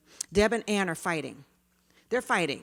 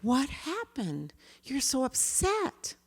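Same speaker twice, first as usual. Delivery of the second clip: slow, soft and higher, soothing; calm and gentle; 7 semitones higher, 3 dB quieter, slower than usual. Shown as a demonstration. Teacher soothing a child in a fight, slow and tender. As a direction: hold the upset child's feelings gently. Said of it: "So I approach calmly".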